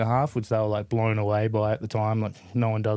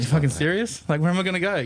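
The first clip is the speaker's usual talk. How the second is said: higher, incredulous questions; disbelief; 6 semitones higher than usual. Retold or imitated. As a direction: voice climbs in shocked disbelief. Kicked out at night, retold.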